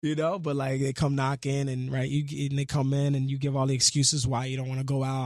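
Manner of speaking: usual speaking voice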